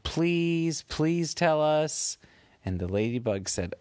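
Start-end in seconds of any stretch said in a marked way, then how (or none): 0.0-2.2 higher, slow begging please; pleading kids. Acted. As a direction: beg sweetly like little kids. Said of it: "Penelope and Jonah said".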